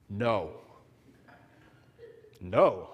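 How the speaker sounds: put-on low, flat child's refusal; a stubborn kid saying no; sulky child no. Sleepy son refusing to leave the car, low sulky no.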